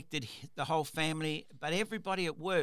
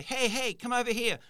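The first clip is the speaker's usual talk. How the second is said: raised call across the workshop, higher and louder; 9 semitones higher, 5 dB louder than usual. Retold or imitated. Boss calling a worker from across the workshop, loud call. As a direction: call her over from the far end. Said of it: "a just slightly louder voice".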